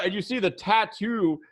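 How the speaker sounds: normal talking voice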